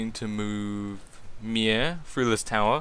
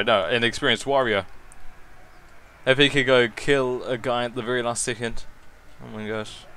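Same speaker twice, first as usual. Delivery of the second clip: ordinary delivery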